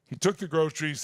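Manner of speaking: normal talking voice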